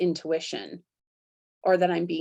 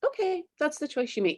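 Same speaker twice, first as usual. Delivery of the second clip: higher, quick, easygoing granny; gentle grandma; 9 semitones higher, faster than usual. Retold or imitated. Grandma voice letting her choose, lightly higher and quicker. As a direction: shrug it off like a kind grandma. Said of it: "she just kinda goes".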